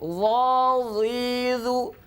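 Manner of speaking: slow chanted Quran recitation. Shown as a demonstration. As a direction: recite it slowly, stretching the vowels. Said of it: "sifat yang senantiasa harus kita jaga".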